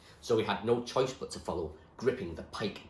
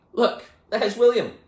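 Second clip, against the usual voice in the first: high, loud, slow call; 13 dB louder than usual. Acted. Doobie calls out, pointing at William, loud. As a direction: point him out across the tower.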